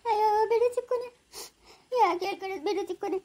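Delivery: high sob fading to a soft whimper; sob, then trail off quietly; crying. Acted. Jaguar to Chikkanna, brushing it off while sobbing, then fading soft. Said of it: "ಯಾಕೆ ಜಾಗ್ವಾರ ಅಳ್ತಾ ಇದ್ದ್ಯಾ?".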